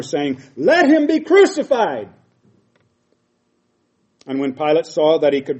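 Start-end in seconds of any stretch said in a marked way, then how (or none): 0.6-2.1 much higher, much louder mob shout; crowd crying out. Acted. The crowd screaming for crucifixion at Pilate, loud shout. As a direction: roar it like an angry mob. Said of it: "they cried out the more".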